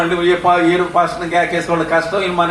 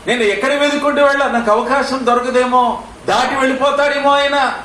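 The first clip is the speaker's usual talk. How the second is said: high, urgent voicing of the blind man's fear; high preaching plea; 7 semitones higher than usual. Preacher voicing the blind man's fear, high and urgent. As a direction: preach his panic in a high voice.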